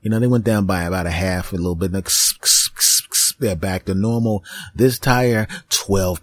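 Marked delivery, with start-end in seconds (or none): 1.9-3.3 short unvoiced hissing bursts; hissing air noise. Filling the tire, hissing like the air hose. Air hiss sound imitated.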